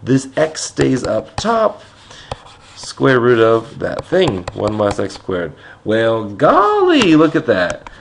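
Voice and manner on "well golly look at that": drawn-out, high, loud golly; hammed-up folksy astonishment; mock amazement. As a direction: play up the wonder, stretching it out. Mock amazement as the terms match.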